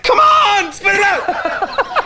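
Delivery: high-pitched, drawn-out impatient yell; imitating an impatient teammate shouting; quarterback's yell. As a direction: yell it like a fed-up quarterback in the huddle. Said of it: "the quarterback's like".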